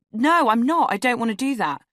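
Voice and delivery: high, loud alarmed protest; alarmed refusal. A narrator's reading. Woman fending off a pushy date, alarmed. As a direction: refuse him in rising alarm, pitch jumping up.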